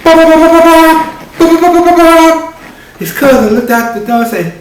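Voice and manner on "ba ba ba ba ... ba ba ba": high loud honking syllables; loud high mock horn blasts; car horn imitation. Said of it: "started blowing the horn".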